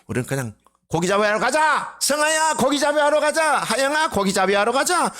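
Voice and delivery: high calling out to friends. Acted. Disciple calling friends to go fishing, high hearty call. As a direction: shout across to a buddy to come fishing.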